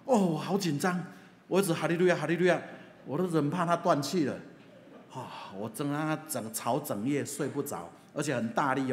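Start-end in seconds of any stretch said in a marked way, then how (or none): 0.1-4.3 mock panic, high and loud; a hammed-up nervous hallelujah; reliving the scare as a joke, high and loud; retold panic.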